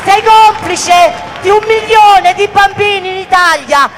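rising shouted rally cry; booming balcony speech. Real, not acted. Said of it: "mi dicono che grido".

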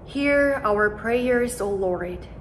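ordinary delivery

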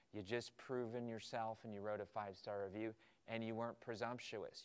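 ordinary talk, nothing marked

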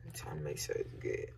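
hushed whisper, no voice. Real, not acted. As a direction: whisper so nobody hears. Hiding the camera.

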